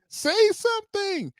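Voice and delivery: very high, stretched-out mock shriek; imitated child's scream. Dad imitating his tantruming daughter, screamed demand. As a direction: wail it like a child in meltdown. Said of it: "she just kept screaming".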